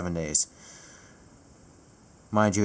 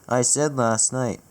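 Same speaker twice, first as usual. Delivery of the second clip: normal talking voice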